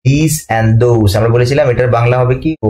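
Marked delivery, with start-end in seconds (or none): 0.1-1.2 slow demo. Shown as a demonstration. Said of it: "আর এটা হচ্ছে আপনার কি those".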